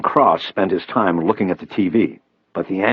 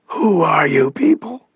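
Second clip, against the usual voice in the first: slow, strained rasp, much higher; raspy voice; 10 semitones higher, slower than usual. A narrator's reading. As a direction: rasp it out with effort. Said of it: "Cross rasped from the screen".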